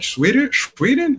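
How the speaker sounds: high rising disbelief, pitch jumping up; surprised repeat. Retold or imitated. Narrator echoing Martin's Sweden in amazed disbelief. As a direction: echo it back like you can't believe it.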